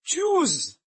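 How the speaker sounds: high-pitched indignant outburst; outraged challenge. Acted. Captive daring an enemy to go on, outraged cry. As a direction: cry out in outrage at being defied. Threatened person challenges the other.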